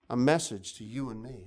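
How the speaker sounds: ordinary talk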